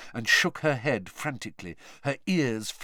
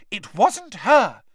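put-on girl speaking up; 11 semitones higher, 9 dB louder, slower than usual. A narrator's reading. Hermione speaking up nervously.

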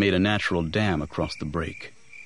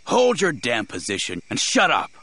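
raised, angry order; snarled; 6 semitones higher, 4 dB louder than usual. A narrator's reading. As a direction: snarl it at your own people. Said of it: "the man snarled".

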